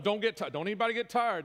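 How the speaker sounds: loud, high mock exhortation; mock preaching. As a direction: preach the misread verse at full tilt. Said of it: "preach a sermon on".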